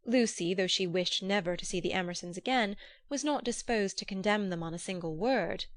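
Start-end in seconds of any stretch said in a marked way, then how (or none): none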